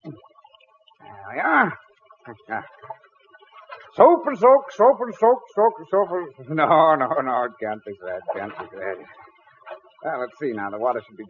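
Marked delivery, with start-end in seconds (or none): 3.9-6.5 tongue twister attempt. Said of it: "I bet you can say that three times fast".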